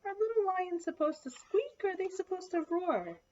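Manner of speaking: put-on high-pitched question to children; puzzled question. A narrator's reading. Reader asks listeners about little lions, high questioning voice.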